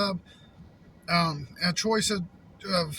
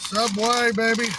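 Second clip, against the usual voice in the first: loud, higher hype for Subway; excited cheer; 4 semitones higher, 8 dB louder than usual. Real, not acted. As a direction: call it out like a cheer. Cheering his meal mid-bite.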